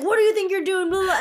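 high, loud imitated outrage with laughter; mock angry yell. Punched man confronts her, imitated angry yell. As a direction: yell it hammed-up, laughing through it. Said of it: "he just got mad".

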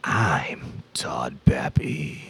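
slow breathy whisper of the name. Pretend. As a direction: whisper it slowly, like a secret.

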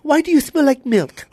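high, puzzled mom voice; lightly voiced mother asking; mom's voice.

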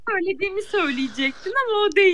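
words squeezed through laughter; laughing while talking. Real, not acted. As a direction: talk through a fit of giggles.

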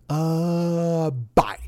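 lightly voiced Eddie Murphy, hammed-up; exaggerated Eddie Murphy. Eddie Murphy trading scene, lightly exaggerated deadpan. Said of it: "really exaggerated".

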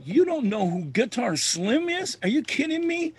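high-pitched retold disbelief; incredulous. Musician to [name] who doesn't know [name], incredulous.

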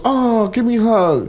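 put-on high little-kid voice asking for the phone; dad imitating his toddler son begging for the phone. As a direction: mimic a sweet toddler begging. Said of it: "Then he goes".

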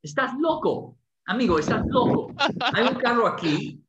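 high, indignant protest to a stranger; you're crazy. Retold or imitated. Storyteller telling the mugger he's crazy, indignant. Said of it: "And I'm like".

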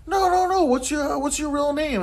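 high, insistent prying voice; hammed-up pushy questioner; mock pushy asshole. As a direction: mimic a nosy guy who won't let it go. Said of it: "some asshole's gonna be like".

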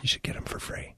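hushed, voiceless whisper; whispered aside. Real, not acted. Bandmate suggesting free eggs, whispered aside. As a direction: whisper it as a sly tip.

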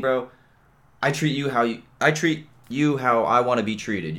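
ordinary talk, nothing marked

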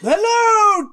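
high, loud camp diva greeting; campy drag queen. Acted. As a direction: gush like a flamboyant hostess. Quiz host persona begins.

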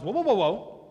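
loud, high, comic protest; God interrupting. Acted. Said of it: "and God says".

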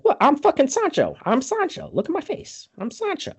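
high, desperate mock plea; imitating pleading Sancho. Retelling Sancho begging for his life, high and desperate. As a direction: squeak out that you're really Sancho. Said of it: "And then he's like".

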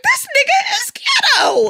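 very high, laughing mock shout; exclaiming. Comic recalling her reaction to the commercial, high laughing shout. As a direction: cry it out in drawn-out comic disbelief.

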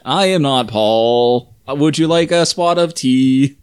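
slow, hammed-up Brit; mock British with stretched words; bad British accent. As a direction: badly fake a British accent. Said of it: "This is bad British accent".

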